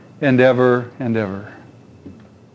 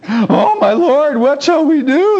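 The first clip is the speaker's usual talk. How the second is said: high, frightened cry; panicked servant; 10 semitones higher than usual. A narrator's reading.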